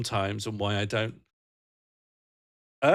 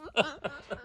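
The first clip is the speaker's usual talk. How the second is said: quiet high giggle; a small squeaky laugh; soft high giggle; 6 dB quieter than usual. Real, not acted. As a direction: giggle trailing off. Laughs after explaining message.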